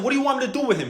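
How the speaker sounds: high, exasperated question; throwing his hands up at the request; exasperated disbelief. Retold or imitated. Exasperated with friend's demand.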